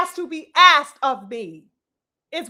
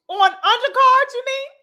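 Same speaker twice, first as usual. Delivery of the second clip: sarcastic retort; 9 semitones higher, 4 dB louder than usual. Real, not acted.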